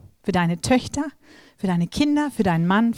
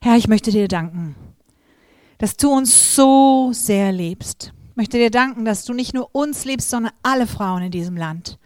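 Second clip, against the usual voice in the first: prayer; 3 semitones higher than usual. Acted. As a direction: pray aloud over the women. Leads the congregation in prayer. (ernstes, getragenes Gebet)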